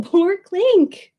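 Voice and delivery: plain reading voice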